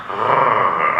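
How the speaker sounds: a low, put-upon ugh; low reluctant groan. Retold or imitated. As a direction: groan like you've been cornered.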